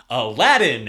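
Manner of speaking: loud booming genie; genie shout. Retold or imitated. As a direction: bellow the name like the genie. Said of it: "he's like".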